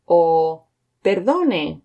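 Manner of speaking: perdone stretched out slowly, each syllable clear; drawn-out teaching take of perdone; slow and careful. Shown as a demonstration. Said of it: "Let's have a look at them again".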